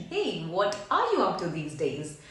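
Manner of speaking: "what ARE you up to", voice rising and falling; modulated question. Shown as a demonstration. Teacher demonstrating a question with your voice modulation, wavy intonation on "are you up to". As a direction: put a wave through "what are you up to". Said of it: "with your voice modulation".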